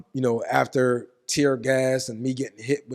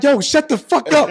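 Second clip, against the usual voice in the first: high, loud, drawn-out acted yell; exasperated shout, pitched way up; retold screaming; 13 semitones higher, 9 dB louder than usual. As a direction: act out yelling at someone to shut up. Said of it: "I'm screaming this at him".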